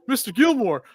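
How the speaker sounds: put-on high, loud kid voice; pleading kid caddy. Kid caddy explaining himself to Gilmore, high mock plea. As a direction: squeal it like an alarmed young caddy.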